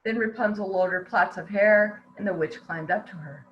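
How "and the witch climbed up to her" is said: usual speaking voice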